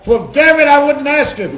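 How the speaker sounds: loud, high-pitched retort; quoted reply shouted for a laugh; emphatic outburst. Retold or imitated. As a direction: burst out in mock exasperation. Retelling the director's emphatic answer.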